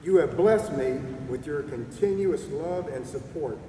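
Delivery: ordinary delivery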